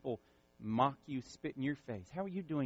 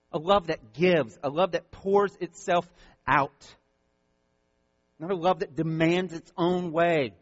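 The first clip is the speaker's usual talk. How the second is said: loud, driving sermon delivery; preaching at full force; 5 semitones higher, 9 dB louder than usual. Acted. Pastor preaching hard to his church. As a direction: pound each line from the pulpit.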